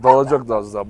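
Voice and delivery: usual speaking voice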